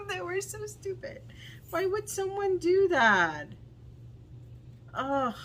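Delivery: high exasperated cry at the seller's mistake; exasperated outburst. Real, not acted. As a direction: squeal of disbelief.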